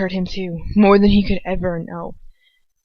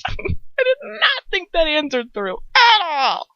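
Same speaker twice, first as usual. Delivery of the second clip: high, slow, squealing laugh-talk; drawn-out hilarity; helpless laughter; 9 semitones higher, slower than usual. Real, not acted. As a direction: wail it through real laughter. Reader laughing at the story.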